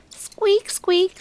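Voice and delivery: high, tiny squeaks like a mouse. A narrator's reading. Little mouse creeping toward tree.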